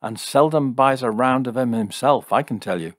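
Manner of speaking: scornful jibe. Acted. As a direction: toss it out as a mocking jibe. Jim's scornful jibe at Fred.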